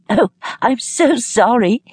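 high, drawn-out apology; crying back amid laughter. A narrator's reading. Lilia apologises to those seeing her off, calling back amused. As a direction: call back high and slow, overcome by amusement. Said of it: "she cried back".

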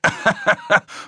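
gehauchtes, hohes Auflachen; kurzes Auflachen. Acted. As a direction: lach überheblich auf. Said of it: "Ackerman lachte auf". (gehauchtes, höhnisches Auflachen)

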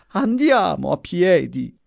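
put-on high, thin old voice, slow on the name; feeble moan. A narrator's reading. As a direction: whimper the plea. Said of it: "gemeva la voce flebile".

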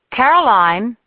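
mother calling daughter to practice; calling up the stairs. A narrator's reading.

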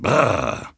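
acted shiver of disgust at cold; trilled brrr, shuddering. A narrator's reading. Grumbler shudders at cold snow. (fröstelndes Brrr)

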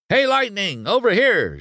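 high-pitched call for attention; shouting reporter. Acted. Reporter to Lightning in the crowd, shouting. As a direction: call out over a jostling crowd. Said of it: "shouted one".

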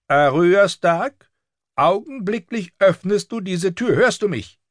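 very high, commanding scolding; furious septa. A narrator's reading. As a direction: demand obedience through a shut door. Furious septa demands door be opened.

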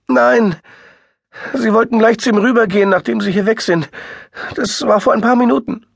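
high, loud, fast and frantic; frantic sister near tears; distraught woman. A narrator's reading. Sister answers.

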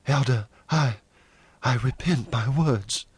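halting, meek retraction; faltering. A narrator's reading. Jane takes back her words to the Elder, faltering. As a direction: break off, then give in hesitantly. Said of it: "Jane faltered".

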